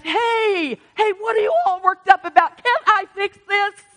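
God asking. Retold or imitated. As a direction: call out as God, high and loud. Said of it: "God's asking us".

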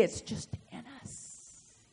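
slow, whispered aside; hushed whisper. Real, not acted. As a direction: drop to a slow whisper for the congregation. Awed aside to congregation.

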